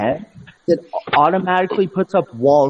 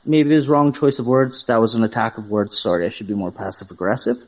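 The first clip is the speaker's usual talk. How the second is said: mock-apologetic, sinking lower; passive-aggressive; 7 semitones lower than usual. Shown as a demonstration. Man sarcastically apologising to the friend he offended, passive-aggressive. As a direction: dial the demeanor down, sinking in pitch. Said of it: "I'll change my demeanor now".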